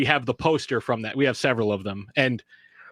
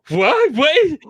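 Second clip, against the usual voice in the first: much higher and louder, rising each time; stunned, can't believe it; shocked disbelief; 12 semitones higher, 7 dB louder than usual. Retold or imitated. Hearing she can't accept, stunned. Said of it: "And I'm like".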